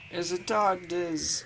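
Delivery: high, slow and gleeful; excited. Real, not acted. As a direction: announce it with delight. Gleeful tardis announcement.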